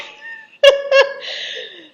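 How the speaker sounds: loud, high burst of laughter. Real, not acted. Reviewer cracking up at the Marquis's new book, high laugh. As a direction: crack up helplessly.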